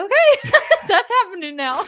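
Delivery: laughing. Retold or imitated. Laughing quote.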